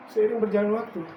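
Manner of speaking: ordinary talk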